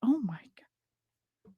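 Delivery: breathless whispered gasp; real whisper. Real, not acted. Whispering to herself over a cute photo, awestruck. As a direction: whisper it under your breath.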